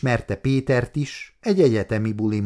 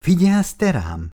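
high, louder mild reproach; mildly scolding; 8 semitones higher, 5 dB louder than usual. A narrator's reading. Péter to his distracted partner Anita, mildly scolding. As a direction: chide lightly, pitched up at the start. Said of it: "kérdezte kissé korholón Péter".

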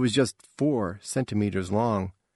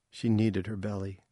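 plain reading voice

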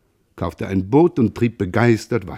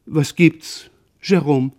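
slightly startled, higher-pitched wife; startled question; 6 semitones higher, slower than usual. A narrator's reading. Startled wife turning to her husband, higher voice. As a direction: ask it higher, roused with a start. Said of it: "Nun schrak sie empor".

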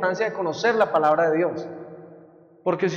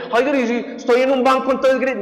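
mock question; 6 semitones higher than usual. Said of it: "A veces me dicen".

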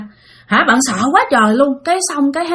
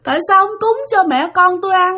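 high mournful wail; crying ghost; 8 semitones higher than usual. Retold or imitated. Ghost mother begging the sleeper for food, in a high tearful wail. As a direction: moan the complaint like a weeping spirit. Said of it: "đang khóc".

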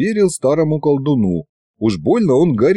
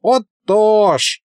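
put-on higher, slow, proud drawl of an old wizard; proudly drawn out; 10 semitones higher, slower than usual. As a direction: stretch it out, full of yourself. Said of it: "горделиво протянул колдун". (горделиво, протяжно)

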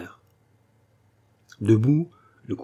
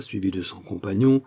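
ordinary delivery, nothing marked

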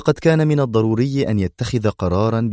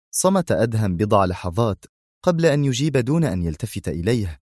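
ordinary delivery, nothing marked

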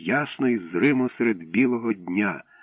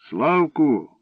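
put-on higher-pitched old man's call; 6 semitones higher, 4 dB louder than usual. A narrator's reading. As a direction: call the boy's name across the water. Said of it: "крикнув дідок".

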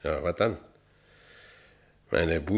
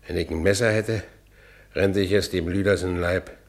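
normal talking voice

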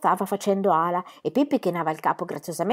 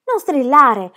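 louder, higher angry snap; furious shout; 5 semitones higher, 8 dB louder, much slower than usual. Acted. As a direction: yell at her to stop. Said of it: "le gridò Tommy con furia".

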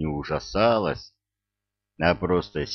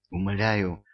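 ordinary delivery

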